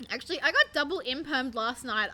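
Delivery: normal talking voice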